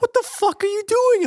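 high-pitched mock yell; imitated yelling. Wife yelling at drunk husband, mock furious. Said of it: "they hear the yelling".

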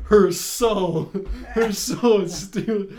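loud mock-dramatic declaiming; parody exposition hero. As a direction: declaim it slowly through laughter. Continues the exposition parody through laughter.

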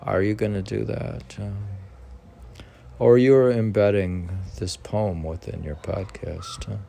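an animated, declaiming text reading; formal reading. Acted. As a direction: read it out with rising energy. Continues reading commentary aloud.

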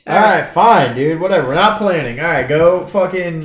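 high, loud irritated give-up; irritated outburst. Real, not acted. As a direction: throw your hands up loudly. Irritated giving in.

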